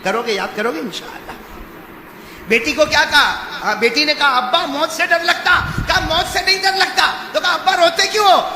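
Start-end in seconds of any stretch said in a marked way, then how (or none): 2.5-8.4 loud, high question-and-answer retelling; fervent preaching. Acted. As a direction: drive the dialogue home at full voice. Preaches at full force. (بلند، جوشیلی خطابت)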